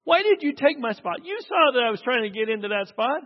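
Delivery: put-on high, loud indignant cry of an old lady; shrill-voiced old lady furious over her parking spot; angry old lady. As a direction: demand it back, outraged. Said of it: "And she got so mad".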